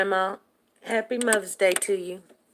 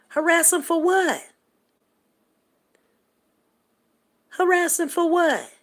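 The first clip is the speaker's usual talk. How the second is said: high-pitched, incredulous challenge; indignant retort; 8 semitones higher than usual. Real, not acted. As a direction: throw the question back, pitched way up. Indignant retort to imagined complainers.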